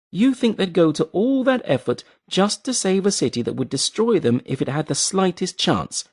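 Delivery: a woman scoffing at his hope; scornful Bellis. A narrator's reading. Bellis voiced, scornful questions.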